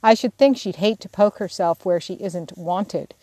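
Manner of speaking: ordinary delivery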